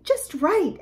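ordinary delivery, nothing marked

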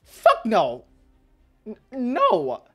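loud, high-pitched incredulous yelling; furious disbelief at full volume; outraged rant. Real, not acted. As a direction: shout the refusal, then rant on.

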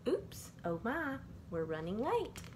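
ordinary delivery, nothing marked

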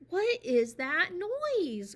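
high, slow, loud bear voice; growled. Acted. Mister Bear complains about the noise, growling. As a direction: drag out the question, annoyed. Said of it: "growled Mister Bear".

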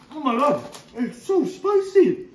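high, loud shocked exclaim; surprised by spice. Acted. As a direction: gasp at the heat of it. Bites into spicy food.

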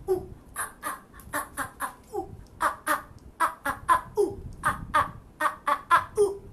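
whispered ooh ah monkey calls; monkey noises. Shown as a demonstration. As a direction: do monkey sounds under your breath. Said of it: "So you go".

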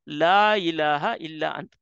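slow, low recited "La ilaha illa ant."; slow recitation. Shown as a demonstration. Preacher reciting the dua to the congregation, slow and low. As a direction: chant it slowly and low. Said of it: "Now look at the dua".

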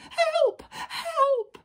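very high, loud squeal for help; panicked scream. A narrator's reading. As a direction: scream as a terrified little wolf. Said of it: "screamed the little wolf".

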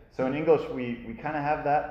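ordinary delivery

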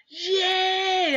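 high, loud, drawn-out yay; yelled cheer. A narrator's reading. Classmates cheer the pizza party, yelled. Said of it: "everybody yelled".

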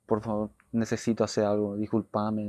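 low, soft plea for forgiveness; subdued, remorseful begging; retold apology. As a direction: apologise quietly, replaying a tearful moment. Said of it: "le pido disculpas a Pedro".

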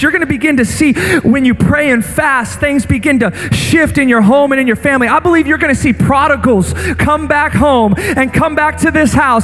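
impassioned sermon over music; full-force preaching. Acted. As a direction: build the altar call with fire.

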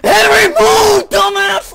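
high, loud drawn-out yell; frantic, annoyed screaming; exasperated shout. Real, not acted. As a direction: yell at the teammate blocking you. Yelling at teammate in the way.

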